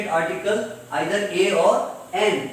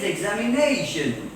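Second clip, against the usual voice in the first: "examination" with NA raised and stretched; stressed syllable before -tion. Shown as a demonstration. Teacher restating the rule, stress on NA in "examination". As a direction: punch the NA in "examination". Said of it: "should be pronounced loudly".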